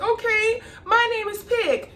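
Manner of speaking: high small meek voice; timid boy. Retold or imitated. Mitch giving in to the reenactor, meek and high. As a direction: squeak it out like a scared kid. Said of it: "Mitch is like".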